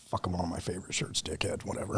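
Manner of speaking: very low, barely audible muttered complaint; resigned grumble. Retold or imitated. Patient to the man cutting his shirt, muttered grumble. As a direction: grumble it under your breath, quick and low. Retold grumble at X-ray technician.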